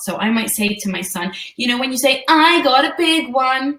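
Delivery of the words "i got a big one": put-on slow, higher, boastful little boy; braggy kid. Mother imitating her son bragging, slow and higher. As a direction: show off like a proud son. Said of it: "that might be a little bit braggy".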